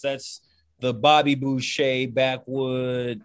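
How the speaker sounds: usual speaking voice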